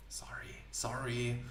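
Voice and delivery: breathy whispered apology; embarrassed whispered sorry. Real, not acted. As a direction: whisper it under your breath, slowly. Apologizing for tripping over name.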